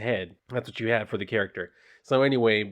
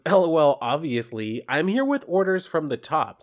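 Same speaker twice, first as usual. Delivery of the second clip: higher, perky mascot voice; cheeky mascot; 6 semitones higher than usual. Acted. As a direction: play the chirpy corporate ghost. Ghost Bud voiced in comic reading.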